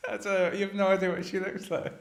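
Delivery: speaking through laughter; laughing. Real, not acted. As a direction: laugh while you say it. Laughing at friend's teasing.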